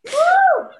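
a high, loud, laughing whoop; whooping cheer. Real, not acted. Hyped about New Orleans men, whooping with laughter. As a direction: let out a delighted woo.

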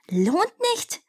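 very high, incredulous screeched question; screeching demon. A narrator's reading. Demon throws the words back at the prince, screeching. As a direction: flip the voice upward in disbelief, without shouting. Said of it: "kreischte der erste Dämon mit überkippender Stimme".

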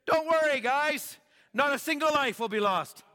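high, loud reassuring call; calling out to crew. Retold or imitated. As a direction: shout comfort across the deck.

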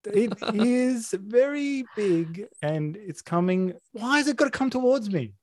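high panicked whining; screaming at a spider. Real, not acted. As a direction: freak out as it crawls closer.

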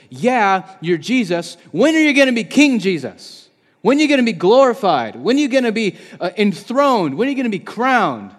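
loud, high, insistent questions swooping downward; impatient disciples. Retold or imitated. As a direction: imitate disciples pressing for an answer. Voicing impatient, demanding disciples.

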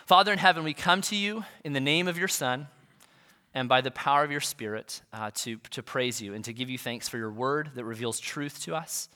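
low, soft praying voice; reverent prayer. Real, not acted. Pastor praying with congregation before opening God's word, reverent prayer.

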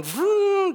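power tool noise. Retold or imitated. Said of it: "the screwdrivers are going".

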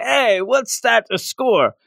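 high, slow teasing heckle; mock heckler. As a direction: tease yourself as a heckler. Mock retort to own score.

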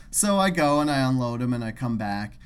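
ordinary talk

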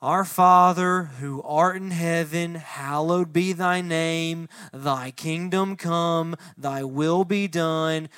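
slow, droning, by-rote prayer; slow dutiful prayer voice; rote recitation. Shown as a demonstration. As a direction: recite it mechanically, no heart. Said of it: "it sounds like this".

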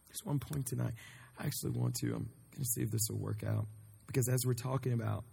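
usual speaking voice

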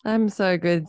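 normal talking voice

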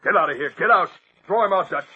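loud, high barked orders; furious yelled command; angry shout. Acted. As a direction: drive him out of the room. Robertson throwing the reporter out.

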